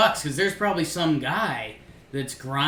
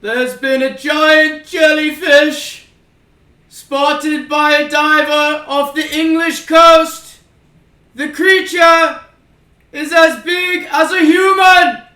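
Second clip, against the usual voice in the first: booming high theatrical orator voice; Roman orator; 16 dB louder, slower than usual. Acted. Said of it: "in your Roman orator voice".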